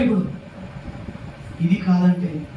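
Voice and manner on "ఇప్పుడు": ordinary delivery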